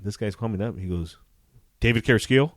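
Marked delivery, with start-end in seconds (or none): 1.8-2.5 raised, higher questioning call. Retold or imitated. Process server approaching an officer at his home, calling out. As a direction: call the name out loudly to check. Stranger calls out his name.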